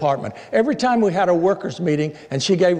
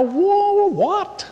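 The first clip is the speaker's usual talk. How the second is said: high squeaky whoa, what; mock surprise. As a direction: feign shock, way up high.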